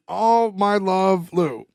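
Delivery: very slow, savoured reading; drawn-out sign-off. Retold or imitated. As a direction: drag out each word like a drunk signing off. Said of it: "you hear Lou going".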